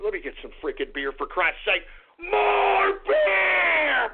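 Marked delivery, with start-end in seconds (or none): 2.2-4.1 bellowed demand. Acted. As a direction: call out for beer like a furious drunk. Demanding beer mid-rant.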